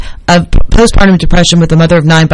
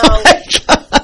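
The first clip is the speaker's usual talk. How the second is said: laughing; 9 semitones higher, 3 dB quieter, slower than usual. Real, not acted. Reacting to flushing the goldfish.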